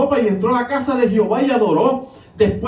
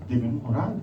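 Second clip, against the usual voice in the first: soft echoed repeat; 10 semitones lower, 8 dB quieter, faster than usual. Shown as a demonstration. Repeated exhortation, soft echo.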